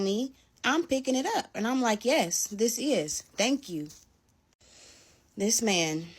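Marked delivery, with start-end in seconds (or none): 2.1-3.9 slow, drawn-out mock gratitude; sarcastic thanks. Picking up the thrown money, mock sweet sarcasm. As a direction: sweetly rub it in.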